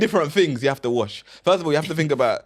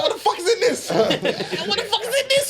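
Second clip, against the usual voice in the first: laughing; faster than usual. Retold or imitated. Flatmate voiced, laughing repeats.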